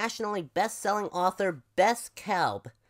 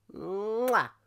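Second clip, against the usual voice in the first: big smacking "mwah"; kiss sound; 6 semitones higher than usual. Acted. Crow kisses the buffalo.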